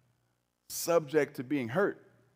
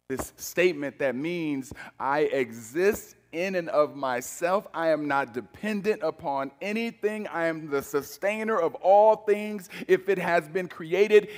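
ordinary talk, nothing marked

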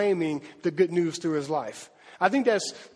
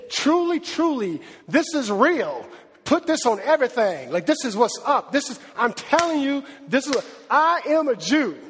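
TRULY, TRULY pitched up and punched; emphatic; 5 semitones higher, 3 dB louder than usual. Shown as a demonstration. Preacher voicing Paul's "verily, verily", emphatic. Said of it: "it was emphatic".

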